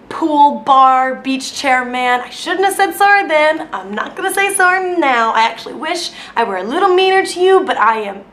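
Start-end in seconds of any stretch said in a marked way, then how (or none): none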